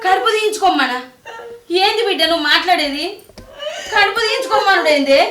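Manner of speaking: loud, high, outraged questioning; shocked disbelief. Acted. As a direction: demand to know, appalled. Mother appalled at abortion demand.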